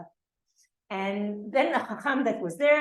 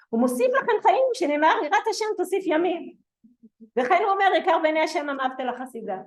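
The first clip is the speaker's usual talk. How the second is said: rapid Hebrew recitation; 6 semitones higher, 5 dB louder, much faster than usual. Retold or imitated. Quoting the angel's answer to the rabbi, rapid louder recitation. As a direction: rattle off the Hebrew source fast and a bit louder.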